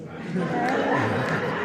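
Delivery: big laugh at the memory; hearty laugh. Real, not acted. Laughs at own story.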